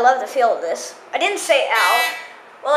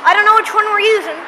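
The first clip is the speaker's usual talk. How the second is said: raised voice over loud machinery; yelling; 5 semitones higher, 5 dB louder than usual. Real, not acted. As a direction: yell to be heard over the noise. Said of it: "Sorry I'm yelling, it's really loud".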